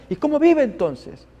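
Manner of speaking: high, astonished question; puzzled relative. Retold or imitated. Relatives pressing the doctor, high and astonished. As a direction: ask it in disbelief.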